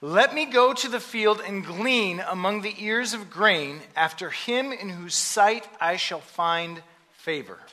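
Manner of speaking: usual speaking voice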